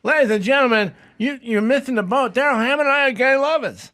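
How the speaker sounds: very high, loud mock yell; Jay Leno. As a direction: holler an announcement in a squeaky celebrity impression. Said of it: "he yells at them".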